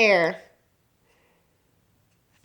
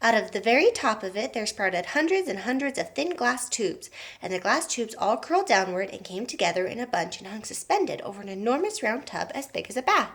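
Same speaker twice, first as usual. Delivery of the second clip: ordinary delivery, nothing marked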